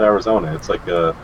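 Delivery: usual speaking voice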